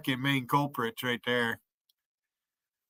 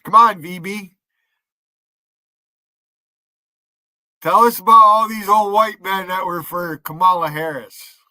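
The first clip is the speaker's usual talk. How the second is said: goading, louder call-out; louder taunting call to VB; 6 dB louder than usual. Real, not acted. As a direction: throw the challenge out loud. Taunting VB to come on.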